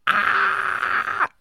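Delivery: very high, loud squeal; excited squeal. Real, not acted. Actor overcome by a favorite recording moment, excited squeal. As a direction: burst into a squeal of delight.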